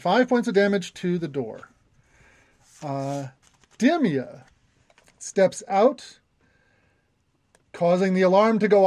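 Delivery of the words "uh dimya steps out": normal talking voice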